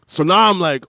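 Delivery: ordinary talk, nothing marked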